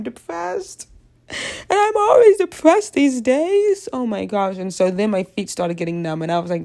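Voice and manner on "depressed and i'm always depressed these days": much higher, slow, louder melodramatic whine; exaggerated mock despair. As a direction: wail it like a hammed-up complaint. Joking about her depression.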